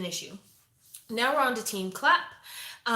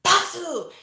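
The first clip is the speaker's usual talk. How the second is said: high, loud imitated demands; hammed-up coach pushing for a shout; loud, high mock coach voice; mock coach yelling; 8 semitones higher, 8 dB louder than usual. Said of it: "And he's like".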